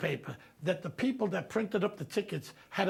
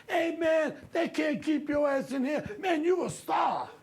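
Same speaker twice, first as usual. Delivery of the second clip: cheering street guy; 9 semitones higher, slower than usual. Retold or imitated. Guys at store cheering.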